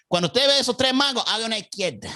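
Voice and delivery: high Cuban Spanish with a laugh breaking in; pointing out the mangos, laughing at the end; Cuban accent. Retold or imitated. Cuban local giving directions to a driver, a laugh slipping in. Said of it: "my Cuban accent was on point".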